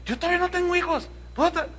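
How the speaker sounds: hammed-up eager woman; hopeful young wife. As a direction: rush it out full of hope. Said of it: "así con mucha esperanza".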